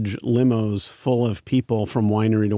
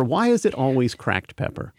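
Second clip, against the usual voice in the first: ordinary talk, nothing marked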